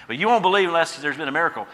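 a touch of Jesus chiding the crowd; voicing Jesus. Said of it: "He's about ready to do a miracle".

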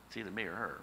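ordinary talk, nothing marked

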